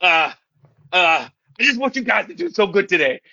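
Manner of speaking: hammed-up squeaky teen rallying the team; awkward kid's pep talk. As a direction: imitate a fumbling teen leader giving a speech. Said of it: "So you're just like".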